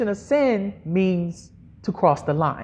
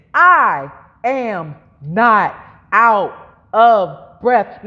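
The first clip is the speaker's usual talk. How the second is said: very slow, each word separated and stressed; word-by-word declaration; 3 semitones lower, much slower than usual. Shown as a demonstration. Preacher modelling a line for congregation to repeat, very slow. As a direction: spell it out word by word, firmly. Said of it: "say it like this".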